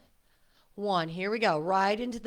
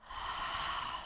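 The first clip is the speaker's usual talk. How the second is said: audible exhale; 10 dB quieter than usual. Shown as a demonstration.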